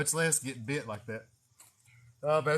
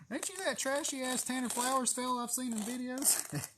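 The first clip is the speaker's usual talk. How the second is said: imitating a stranger; 9 semitones higher, 6 dB quieter than usual. Stranger recognizing the snake guy, voiced high and soft. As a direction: play a curious local who spots him.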